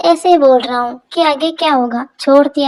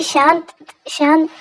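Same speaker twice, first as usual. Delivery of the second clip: drawn-out calming words; slow soothing hush; 2 semitones higher, slower than usual. Acted. Husband soothes his angry wife, slow and calming. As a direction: gently settle her down.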